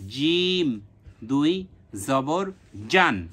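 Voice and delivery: drawn-out জিম with a firm j; emphatic model of hard জিম; hard jim. Shown as a demonstration. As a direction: spell জিম দুই জবর জান slowly, j pressed hard. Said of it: "জিমটা যেমন শক্ত করে উচ্চারণ করবেন".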